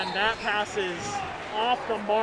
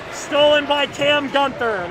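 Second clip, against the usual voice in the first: loud, lifted call of the steal; excited; 5 semitones higher, 7 dB louder than usual. Real, not acted.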